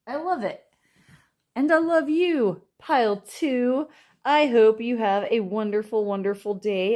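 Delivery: high, loud and beaming; gushing excitement. Real, not acted. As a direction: burst out with delight. Cheerful sign-off to viewers.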